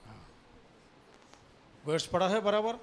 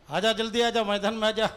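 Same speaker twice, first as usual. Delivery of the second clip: calling out; 5 semitones higher, 4 dB louder than usual. Real, not acted. Pastor calling Sonu to the stage, raised coaxing call. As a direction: call him out to the front.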